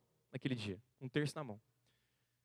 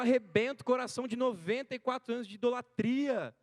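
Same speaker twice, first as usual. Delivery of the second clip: raised, higher preaching voice, slow in places; fervent preaching; 7 semitones higher, 4 dB louder than usual. Acted. Preacher urging the congregation not to give up, fervent preaching. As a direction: drive each point home from the pulpit. Preacher drives lessons home.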